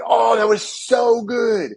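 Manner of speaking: high, slow, blissed-out groan of relief; mock dad relief. Dad's hip pops under his son's pull, high drawn-out relief.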